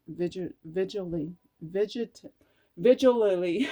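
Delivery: trips over "vigilly", "vigit-", "vigilally" before giving up; stumbling over a word. Real, not acted. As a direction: fumble the word "vigilally" in several broken tries. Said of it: "I can't even say that".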